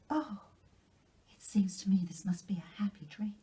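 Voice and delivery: put-on high, breathless delighted girl; young girl bubbling over with Christmas wonder; excited Anne. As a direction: gush with joy over the gift. Anne overwhelmed by the dress.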